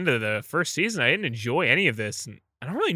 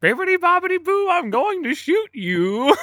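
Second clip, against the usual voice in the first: fairy godmother voice. Acted. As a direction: chant it like a twee fairy. Mock fairy godmother spell.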